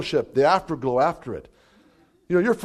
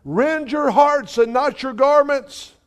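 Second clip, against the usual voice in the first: slow, high and louder proclamation; prophesying; 10 semitones higher, 5 dB louder, much slower than usual. Retold or imitated. Wife prophesying at the rabbi's door, slow and raised. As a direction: draw it out like a prophet speaking over a door. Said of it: "begins to prophesy".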